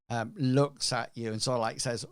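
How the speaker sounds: ordinary talk, nothing marked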